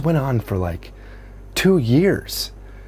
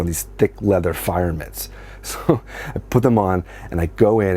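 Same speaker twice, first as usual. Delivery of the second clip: ordinary delivery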